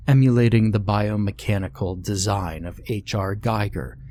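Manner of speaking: ordinary delivery, nothing marked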